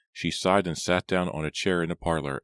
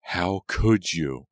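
loud, slow, drawn-out accusation; shriek; 2 semitones higher, 5 dB louder, much slower than usual. A narrator's reading. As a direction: hurl it at her, restrained shriek. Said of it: "Elizabeth shrieked at the top of her lungs".

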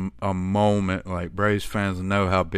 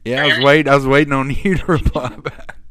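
higher, louder, breaking into a real laugh; amused laughing; 5 semitones higher, 8 dB louder, faster than usual. Real, not acted. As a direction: laugh through the words at a mix-up. Laughs at awkward wait for reply.

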